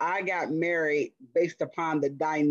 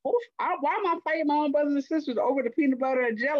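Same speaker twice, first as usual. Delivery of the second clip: put-on high, fast exasperated kid voice; mock child self; 10 semitones higher, faster than usual. Remembering sibling fights, high fast exasperated child. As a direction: play the bewildered kid fed up with fighting. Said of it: "I'm like".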